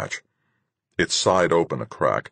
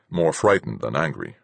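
ordinary delivery

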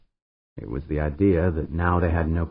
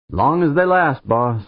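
higher and louder, tossed off; Pancho's voice; 8 semitones higher, 6 dB louder than usual. Acted. Pancho leaving for the hatch, calling back higher. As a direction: call it back higher over the shoulder.

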